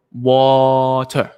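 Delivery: "Water" with a long, held "Wa", then "ter"; split into syllables. Shown as a demonstration. Parent showing two syllables to copy, drawn-out "Water". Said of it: "to signify that are two syllables".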